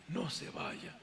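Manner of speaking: whispered high plea; pleading whisper. Retold or imitated. Dying man whispering to the preacher, pleading. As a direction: beg softly not to be left.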